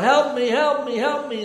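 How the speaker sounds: loud desperate cries of help; hollering for help. Acted. Man hanging from a cliff, hollering for help. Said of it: "he's hollering out".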